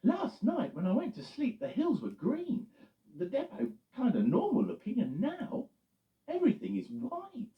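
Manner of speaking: cheerful high truck voice; Eddie the truck. Acted. Eddie the truck asking Loretta what happened, puzzled. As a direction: play Eddie bright and puzzled.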